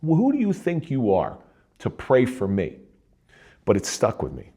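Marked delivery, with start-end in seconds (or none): 0.0-2.8 higher-pitched indignant retort, mimicking past anger; retold furious outburst. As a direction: replay the moment you snapped at him, half-acted. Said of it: "I get furious".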